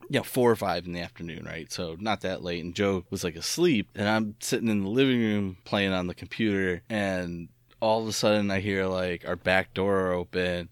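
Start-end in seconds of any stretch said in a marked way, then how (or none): none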